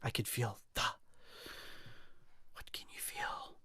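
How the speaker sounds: soft breathy whisper, no voice. Pretend. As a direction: hush it down to a whisper. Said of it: "I feel like I have to whisper now".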